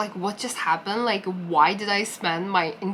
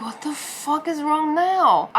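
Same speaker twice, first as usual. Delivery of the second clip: exasperated; 6 semitones higher, 3 dB louder than usual. Retold or imitated. Shocked by his odd remark.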